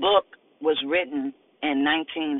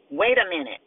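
high-pitched acted shout. Officer stops her new partner leaving, high shout. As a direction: call him back sharply. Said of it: "she shouts".

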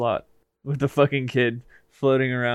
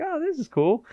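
dog voice. Retold or imitated. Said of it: "be like".

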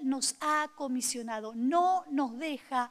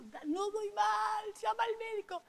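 retold tearful plea, pitched higher; crying; 8 semitones higher, 5 dB quieter than usual. Wife begging husband at night, mock crying. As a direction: imitate sobbing out the words to him. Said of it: "se lo dije llorando".

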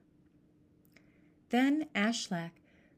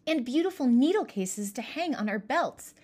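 put-on higher, eager girl delighted with a gift; exclaiming; 4 semitones higher, 5 dB louder than usual. Merja to her siblings about the needle cases, excited. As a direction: gush over the present. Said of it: "exclaimed Merja".

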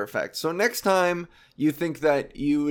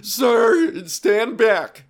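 high, louder nervous "sir"; imitating timid bystander; 5 semitones higher, 6 dB louder, slower than usual. Bystander filming a fight pleads with a man, high and nervous. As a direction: hold the phone and plead from afar. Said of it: "don't just stand there going".

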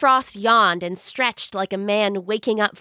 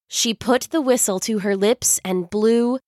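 ordinary delivery, nothing marked

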